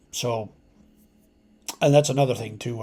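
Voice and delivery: usual speaking voice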